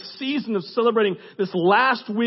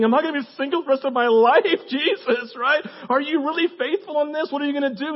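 desperate question; 7 semitones higher than usual. Retold or imitated. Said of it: "desperately single going".

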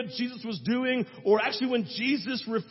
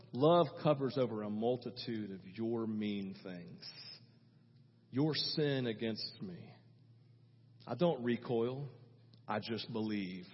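very low, soft, forgiving mother; 10 semitones lower, 9 dB quieter than usual. Retold or imitated. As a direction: absorb the hurt, hushed and deep. Voicing moms who forgive.

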